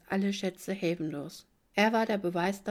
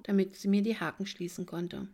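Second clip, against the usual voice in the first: ordinary delivery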